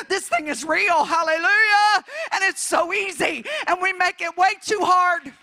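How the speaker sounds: high, loud climactic declaration; full-force preaching. Real, not acted. Preacher declaring to the church that it's so easy, full-force preaching. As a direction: proclaim it to the back pew.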